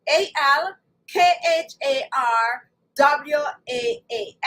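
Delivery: slow, separated letters; slow spelling. Shown as a demonstration. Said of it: "let me say slowly".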